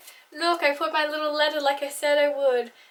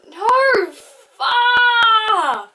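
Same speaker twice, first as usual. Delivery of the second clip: high, loud cry of annoyance; exasperated shout; frustrated outburst; 9 semitones higher, 8 dB louder than usual. Real, not acted. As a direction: yell at yourself for the slip. Frustrated at own flub.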